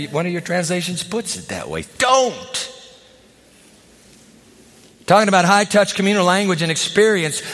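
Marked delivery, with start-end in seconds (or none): 2.0-2.7 a single punched shout of Don't; emphatic shout. Real, not acted. Preacher shouting a warning to the congregation. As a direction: bark the word as a command. Preacher driving the point home.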